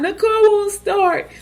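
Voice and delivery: high, slow wailing repeat. Retold or imitated. Crying on the phone to her husband. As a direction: whimper it out like a helpless new mom. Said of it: "I'm crying".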